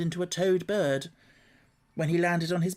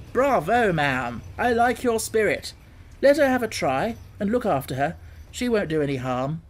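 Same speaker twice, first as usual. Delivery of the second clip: louder, hearty approving voice; hearty gentleman; 4 semitones higher, 5 dB louder than usual. Acted. Gentleman cheering the washerwoman on, hearty and louder. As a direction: boom your approval.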